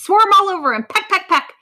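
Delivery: high, loud battle orders; shouted instructions. A narrator's reading. Vulture directing her flock onto the lion, shouted instructions. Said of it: "Vera was shouting instructions".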